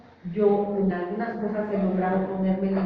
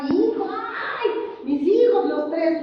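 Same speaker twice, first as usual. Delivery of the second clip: higher, louder hammed-up lament; dramatic distraught mother; 7 semitones higher, 4 dB louder than usual. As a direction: overplay a desperate mother arriving in tears. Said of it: "así llegué yo, este, dramática".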